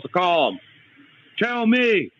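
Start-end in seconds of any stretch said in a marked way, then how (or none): 1.4-2.1 higher-pitched demand, the second line fast; real angry demand. Real, not acted. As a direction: throw the question back at them, furious. Demands answer about daughter's kidnapper.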